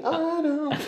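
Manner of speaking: very high, playful put-on voice; mock high-pitched quote. Joking as the pregnant singers, mock high voice. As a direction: leap way up for a joking echo.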